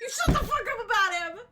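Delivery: shouting it loud and high through laughter; loud laughing yell. Acted. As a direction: yell it back hard, cracking up. Joins the mock sibling yell.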